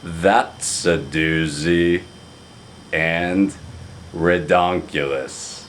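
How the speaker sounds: a light mock of a friend's slow pet phrases; slow catchphrase imitation; Craig's dinerisms. Shown as a demonstration. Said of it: "I can repeat a few of those for you".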